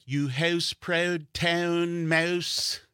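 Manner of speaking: put-on slow, high Canadian accent. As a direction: drag out each ou in Canadian. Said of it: "the Canadian accent".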